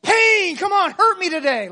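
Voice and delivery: high, loud, hammed-up cry begging for pain; mock-eager masochist shouting a challenge. Masochist arriving at work asking to be hurt, hammed-up eager shout. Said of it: "be like a, a masochist and going to work and say".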